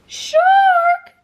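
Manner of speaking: very high, loud panicked squeal; terrified shriek. A narrator's reading. Earthworm shrieking at the approaching shark, terrified. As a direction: scream the warning in terror. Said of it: "shrieked the earthworm".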